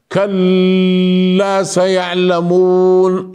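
كلا سيعلمون said slow and thick, as if the tongue sticks; mock wrong reading of كلا سيعلمون, slow and mushy; sticky-mouthed recitation. Shown as a demonstration. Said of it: "تشعر أن في فمه شيء".

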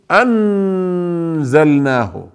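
أنزلناه with a full, clear نون, tongue pressed up to the palate, slow; wrong pronunciation. Shown as a demonstration. Teacher showing students the wrong way, slow. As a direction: say أنزلناه pronouncing the نون fully, as the mistake. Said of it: "لا نقول".

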